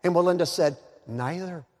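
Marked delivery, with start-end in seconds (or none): 1.1-1.7 soft, matter-of-fact reply; understated deadpan answer. Acted. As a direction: drop the answer quietly. Walenda's deadpan answer to nervous weatherman.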